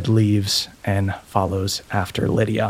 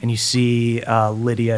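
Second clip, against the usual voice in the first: usual speaking voice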